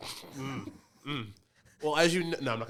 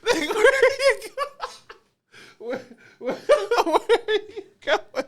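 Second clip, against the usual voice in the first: calling out through laughter; breaking up laughing; 6 dB louder than usual. Real, not acted.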